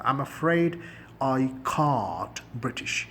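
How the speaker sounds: can't with a broad British ah, slow; British accent. Shown as a demonstration. Teacher contrasting British can't with American, slowly. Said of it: "British لہجہ بن جائے گا".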